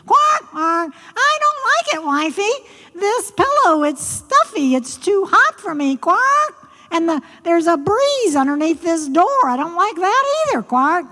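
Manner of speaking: high, whiny frog voice; very high, peevish frog complaint; complaining frog. Acted. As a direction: fuss like a pampered frog at bedtime.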